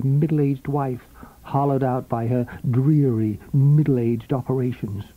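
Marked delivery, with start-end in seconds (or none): none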